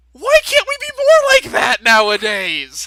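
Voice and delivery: mock outrage. Host mocking modern Catholics, gasping in mock outrage. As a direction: pretend scandalised lament. Ironic gasp at past loyalty.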